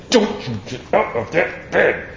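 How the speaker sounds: stern parent. Retold or imitated. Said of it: "shut the door and say".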